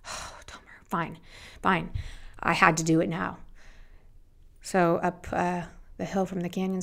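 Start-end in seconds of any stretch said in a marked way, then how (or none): 0.1-1.9 breathy, near-whispered giving in; resigned. Retold or imitated. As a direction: sigh it out and give up. Resigned reply after a sigh.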